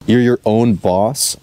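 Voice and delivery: usual speaking voice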